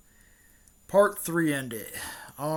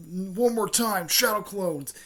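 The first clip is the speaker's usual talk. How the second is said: raised-pitch technique call; mild battle cry; 5 semitones higher, 3 dB louder, slower than usual. Retold or imitated. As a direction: announce the move like an anime hero, restrained. Naruto calls out his technique.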